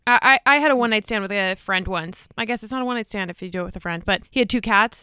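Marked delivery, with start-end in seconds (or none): none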